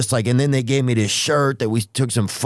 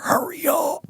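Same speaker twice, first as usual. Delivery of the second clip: unvoiced, urgent whisper; a whispered hurry up to God; whispered plea; 4 dB quieter than usual. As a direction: hiss it under your breath. Impatient prayer at slow man.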